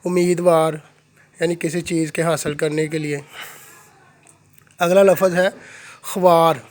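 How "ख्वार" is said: ordinary delivery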